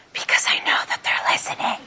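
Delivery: hushed, conspiratorial whisper; mock-paranoid stage whisper; secretive whisper. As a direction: whisper as if spies might overhear. Confiding as if being spied on.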